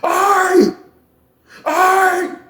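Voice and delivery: very high strained wail; imitated desperate, drawn-out yelp; pleading surrender. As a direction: cry out giving up. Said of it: "All you heard was".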